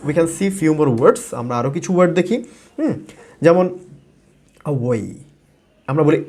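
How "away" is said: "away" as uh-WAY, opening vowel barely there; weak first syllable. Shown as a demonstration. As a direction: say "away" low, stressing the second syllable. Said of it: "শুরুর দিকে একটু stress কম". ("away"-এর শুরুতে কম, শেষে বেশি stress)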